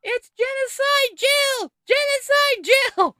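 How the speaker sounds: very high, drawn-out falsetto yell; mock heckler. Host imitating a protester heckling Jill Biden, mock high-pitched yelling. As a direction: screech it like a caricatured protester. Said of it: "was heckled at a campaign stop".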